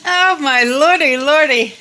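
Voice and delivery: excited. Real, not acted. Excited showing canning.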